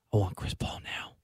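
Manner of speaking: intense whisper; whisper yelling. Retold or imitated. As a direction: whisper it urgently. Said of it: "It's like a whisper".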